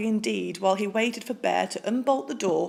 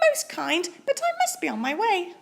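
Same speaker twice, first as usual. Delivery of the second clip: much higher squeaky mouse; a small bright-eyed mouse piping up; tiny mouse voice; 10 semitones higher than usual. Acted. Polite mouse asks Bear for cheese, squeaky high.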